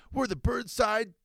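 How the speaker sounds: high, slow surf bro voice; mock gang boast. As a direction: announce the gang like a puffed-up kid. Acted gang name boast.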